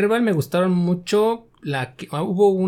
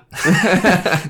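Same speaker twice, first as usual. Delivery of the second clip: a short laugh, louder and falling; sheepish laugh; 4 semitones higher, 7 dB louder than usual. Real, not acted. Laughing to the interviewer about not playing Kerbal. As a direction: laugh at your own confession. Admits he doesn't play.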